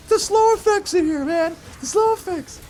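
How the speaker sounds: very high whiny complaint; shrill exasperated whine. Retold or imitated. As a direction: squeal in mock outrage. Complaining about slow effect.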